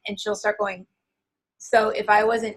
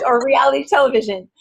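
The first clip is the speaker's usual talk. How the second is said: normal talking voice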